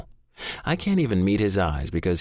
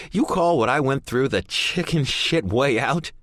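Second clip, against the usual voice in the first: raging; 7 semitones higher than usual. A narrator's reading. Son raging at his father.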